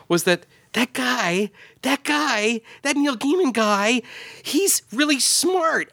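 high, slow mock awe; exaggerated amazement, drawn out slowly; amazed.

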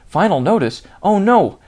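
a hammed-up jolt of panic at "Final notice"; mock panic. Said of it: "there is a moment where you're like".

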